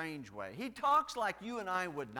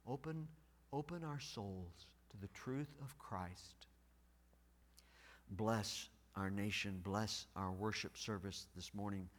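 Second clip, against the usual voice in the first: soft, low, hushed prayer; 8 semitones lower, 8 dB quieter than usual. Real, not acted. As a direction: close the prayer quietly. Closing the opening prayer.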